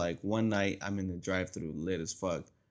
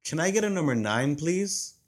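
higher, louder overly polite order; ordering at the drive-thru, a little higher and louder; polite drunk order; 5 semitones higher, 4 dB louder, slower than usual. Retold or imitated. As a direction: ask for it sweetly. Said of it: "I'm like".

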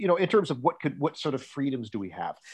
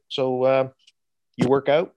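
deadpan; 5 dB louder than usual. Retold or imitated. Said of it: "sort of flatly said".

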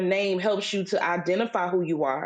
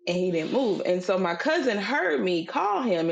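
ordinary delivery

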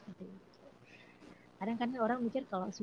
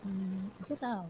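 ordinary talk

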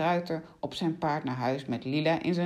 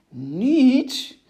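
loud, higher, indignant exclamation; affronted outburst, much louder; offended cry; 7 semitones higher, 10 dB louder than usual. A narrator's reading. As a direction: throw the word back, deeply insulted. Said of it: "riep Lila beledigd".

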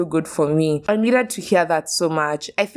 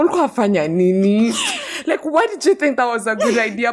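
laughing while scolding younger self; 5 semitones higher than usual. Retold or imitated. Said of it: "I'm like".

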